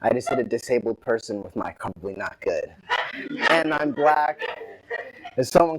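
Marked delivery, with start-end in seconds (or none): 0.0-2.7 low, soft thinking aloud; muted deliberation. Retold or imitated. Driver alone realizing he hit someone, muted deliberation. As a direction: drop down low, like a private worried thought.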